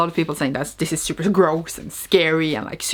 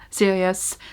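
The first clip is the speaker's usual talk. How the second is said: series with a Norwegian accent, rolled r, long final s. Real, not acted. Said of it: "Norwegian accent really came out strong there".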